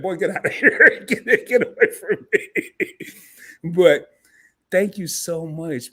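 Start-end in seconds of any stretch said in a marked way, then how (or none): 0.0-2.5 loud, laughing brush-off from a proud father; laughing dismissal. Retold or imitated. Late father teasing his son about Barbara Walters, imitated laughing brush-off. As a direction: shoo him away through laughter, imitating his dad. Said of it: "He would have been like".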